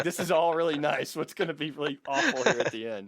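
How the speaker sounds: half-laughing dread about the twist; laughing inner voice. Retold or imitated. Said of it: "mock inner thought".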